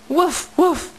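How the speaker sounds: dog bark imitation. Shown as a demonstration. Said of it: "What does a dog say?".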